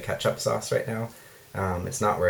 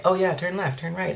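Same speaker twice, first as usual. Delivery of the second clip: higher, chirpy imitation of a navigation app; imitating Google Maps directions, higher pitched; mock GPS voice; 9 semitones higher than usual. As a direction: play the phone giving directions, bright and high. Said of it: "and it's like".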